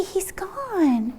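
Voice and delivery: unvoiced hushed whisper. Real, not acted. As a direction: whisper it like a secret. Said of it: "whispers to child".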